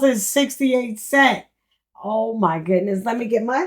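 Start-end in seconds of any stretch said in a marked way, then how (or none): none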